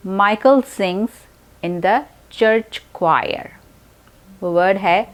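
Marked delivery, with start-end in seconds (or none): 0.1-3.5 very slow dictation pace; slow example sentence. Shown as a demonstration. Teacher reading an example very slowly. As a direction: spell it out slowly in speech. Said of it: "हम एक example देखते हैं".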